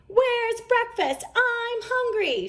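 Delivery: Papa playing a hungry cub; whiny cub demand. A narrator's reading. Papa acting like a cub demanding breakfast, whiny. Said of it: "shouted Papa".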